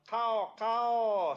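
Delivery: high squawking bird caw; raven caw. Acted. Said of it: "says the raven".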